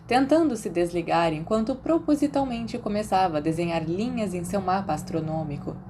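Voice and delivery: ordinary delivery, nothing marked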